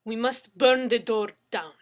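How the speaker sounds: loud, insistent Russian woman; vehement and sharp. A narrator's reading. Anna insists to the men, vehement and loud. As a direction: insist fiercely. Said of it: "Anna insisted, vehement and sharp".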